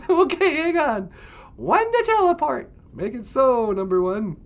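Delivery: squeaky sci-fi captain; high puppet voice. Pretend. Puppet voice teleports.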